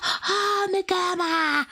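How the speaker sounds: mock demon shriek of pain; pained scream. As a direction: howl as if the cross scorches you. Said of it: "también grita".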